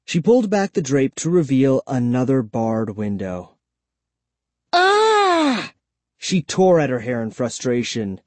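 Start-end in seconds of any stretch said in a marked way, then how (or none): 4.7-5.7 frustrated cry. Acted. Kara frustrated at bars.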